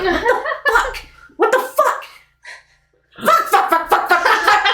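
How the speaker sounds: high, frantic cursing under a trapdoor; cussing NPC woman. Acted. Trapped woman cursing under the floor, high and frantic. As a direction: swear up a storm in a panicked high voice. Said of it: "you hear cussing".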